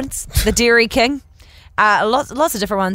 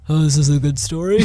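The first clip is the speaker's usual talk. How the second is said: mock unimpressed; 6 semitones lower than usual. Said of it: "looked at this thing like".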